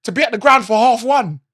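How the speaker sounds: usual speaking voice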